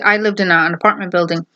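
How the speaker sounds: ordinary delivery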